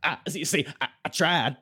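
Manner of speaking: high, halting accent impression; AJ Styles's accent. Teased about the failed impression, answers in the hammed-up accent. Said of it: "you sound like you're doing Kenny doing A J Styles".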